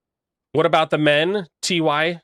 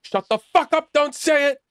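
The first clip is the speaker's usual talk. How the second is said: much higher, frantic mock lawyer voice; panicked lawyer; 14 semitones higher than usual. Lawyer in his ear begging him to stop, high and panicked. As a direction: squeak out a frantic lawyer's warning. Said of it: "He's like".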